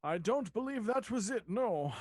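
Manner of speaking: a hint of an in-character voice; NPC voice. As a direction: put on a light NPC voice. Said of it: "I'm trying to do an N P C voice here".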